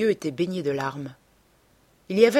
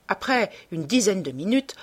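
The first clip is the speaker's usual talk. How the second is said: ordinary delivery, nothing marked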